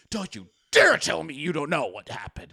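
retold outraged warning; upset accusation. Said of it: "she's getting so upset".

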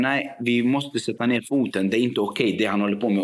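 ordinary delivery

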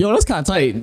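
quoting himself through a laugh. Retold or imitated. Retelling his own reply, laughing.

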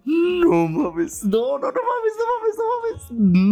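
high, loud squeal of disbelief; grito eufórico. Retold or imitated. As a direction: relive the shock, squeal it very high. Retells seeing he passed. (grito agudo de emoción incrédula)

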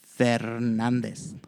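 "Fernandez" drawn out slowly with a Spanish R; correct Spanish pronunciation. Shown as a demonstration. Showing listeners the right surname, careful Spanish pronunciation of "Fernandez". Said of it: "pronounce that last name correctly".

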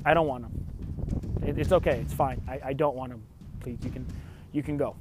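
low, soft, tired turning-away; quiet refusal. Retold or imitated. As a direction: hush her off gently but firmly. Quietly refusing grandma at door.

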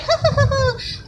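high cackling laugh; demon cackle. Retold or imitated. Demons gloating over defeat, high cackle. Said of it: "demons are".

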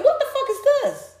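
high, laughing what-the-heck; laughing disbelief. Real, not acted. Disbelief at the contestant.

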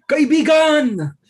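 slow, high, loud showbiz host call; Boy Abunda impression. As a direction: announce it like a talk show host. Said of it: "Paano i-introduce ni Boy Abunda?".

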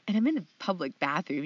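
usual speaking voice

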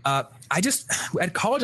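normal talking voice

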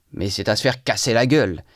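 ordinary delivery

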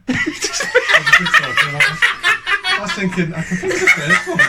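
high, loud laughing speech. Real, not acted. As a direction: talk through the laughter. Laughing while explaining.

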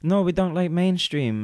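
high, picky mock voice; imitating the girls. Mimicking the picky girls turning down mainstream, high voice. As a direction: pitch it up, snooty about mainstream. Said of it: "And they were like".

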